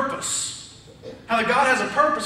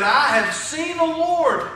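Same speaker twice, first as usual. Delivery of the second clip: higher, drawn-out cry of joyful excitement; excited proclamation; 5 semitones higher, 3 dB louder, slower than usual. Retold or imitated. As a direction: announce it with excitement, voice lifted. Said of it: "tell the excitement in her voice".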